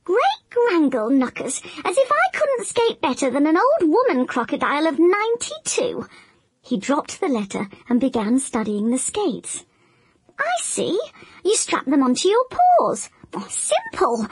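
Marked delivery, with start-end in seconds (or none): 0.0-6.1 higher, huffy crocodile voice. Acted. Crocodile scoffing at grandmother's letter, higher and huffy. 10.4-14.3 higher, curious crocodile voice; crocodile figuring it out. Acted. Crocodile studying the skates, higher and curious.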